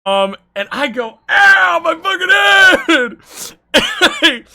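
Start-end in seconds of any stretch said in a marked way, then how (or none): 1.3-4.5 high, loud yelp of pain, stretched out slow; replaying his scream, high and loud; pained yell. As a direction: yell it like the clock just hit you. Retelling the clock hitting his head.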